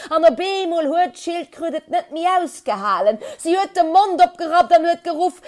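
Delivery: loud dramatic build-up; building excitement. A narrator's reading. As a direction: build the storyteller's suspense. Climax, turtle loses patience.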